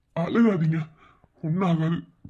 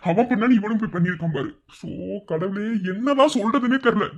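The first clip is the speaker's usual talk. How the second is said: ordinary talk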